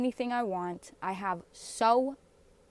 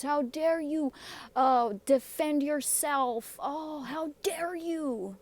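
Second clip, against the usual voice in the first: sarcastic mock outrage; 6 semitones higher than usual.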